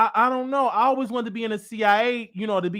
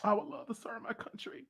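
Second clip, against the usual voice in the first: unvoiced whisper, choked with emotion; emotional whisper; 13 dB quieter than usual. Real, not acted. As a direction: whisper it, moved to tears. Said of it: "I just got emotional".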